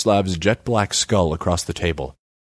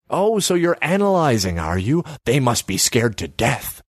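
high, mocking mock-amazement; high-pitched sarcastic taunt; 6 semitones higher than usual. A narrator's reading. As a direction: needle him with false awe. Gangster mocks politician's committee.

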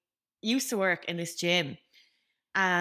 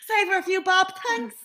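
high, quick, laughing gush; mock grateful child; 12 semitones higher, 5 dB louder than usual. Playing along with co-host, high mock gratitude through laughter. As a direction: gush loudly in a put-on high voice. Hams up gratitude for free food.

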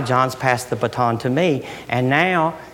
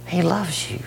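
hushed whisper of comfort; 4 semitones higher, 3 dB quieter than usual. Real, not acted. Preacher whispering to the congregation. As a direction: breathe it out softly.

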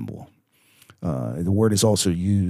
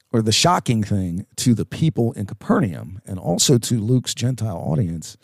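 usual speaking voice

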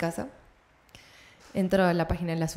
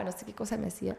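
ordinary talk, nothing marked

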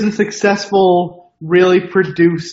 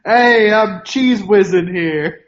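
slow, stretched mock Philadelphia accent; Philly accent; 4 semitones higher, much slower than usual. After being told it sounds like Philly, mock Philly accent. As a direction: ham up a Philly guy bragging. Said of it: "sounds almost more like Philly".

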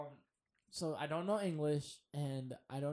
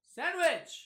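high shouted "sandwich"; shouted insistence; 12 semitones higher, 6 dB louder than usual. Retold or imitated. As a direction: yell the word like a desperate customer. Mocking himself insisting loudly.

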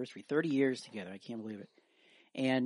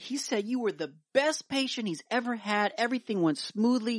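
high, bright nurse voice; cheery nurse; 9 semitones higher than usual. Acted. Nurse cheerfully tells the wife about the doctor's rose. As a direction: lift it up like a chirpy nurse.

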